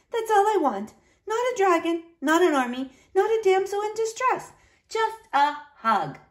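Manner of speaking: put-on high, gentle child's voice; little niece, pleading. A narrator's reading. Niece asking uncle only for hugs, gentle pleading. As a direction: plead simply, slowing at the end.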